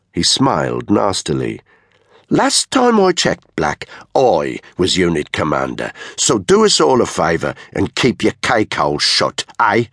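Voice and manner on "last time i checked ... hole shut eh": cocky commander shutting down a rival; sneering unit commander. Acted. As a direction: pull rank with a taunting edge. Blond leader taunts Danny.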